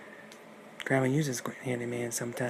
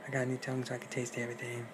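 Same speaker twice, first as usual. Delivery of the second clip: quick hushed; 7 dB quieter, much faster than usual. Acted. Wolf as Grandma explains.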